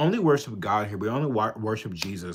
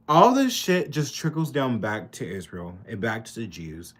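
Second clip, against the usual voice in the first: normal talking voice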